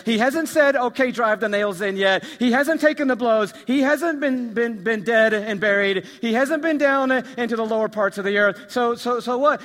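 loud, high, pressing list of objections; impassioned preaching. Acted. Preacher to church, loud rising objection. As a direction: hammer each hasn't like a challenge. Preacher voicing doubting listener.

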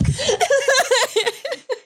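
high burst of real laughter; laughing. Real, not acted. Cracking up while telling a joke to friends, high laughter. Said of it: "two points for laughing in the worst part".

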